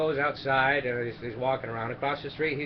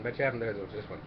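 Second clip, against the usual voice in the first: quiet aside; 4 semitones lower, 6 dB quieter than usual. Shown as a demonstration.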